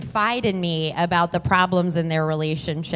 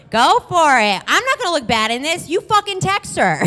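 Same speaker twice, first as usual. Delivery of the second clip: high, raised-voice dare; defiant egging-on; 8 semitones higher, 4 dB louder than usual. Retold or imitated. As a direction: throw it out as a mock challenge. Defiant inner reply, acted.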